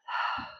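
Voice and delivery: long audible sigh out; open, releasing sigh; demonstrated exhale. Shown as a demonstration. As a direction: let the held breath go in a sigh. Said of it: "release the breath".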